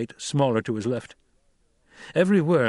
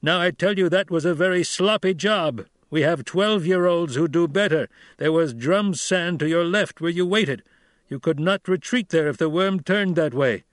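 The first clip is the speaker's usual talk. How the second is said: raised, firm voice of a stern desert chief; Stilgar, stern Fremen leader; 6 semitones higher than usual. A narrator's reading. Stilgar rebuking Paul after his sloppy ride, stern. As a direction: scold the young rider with authority.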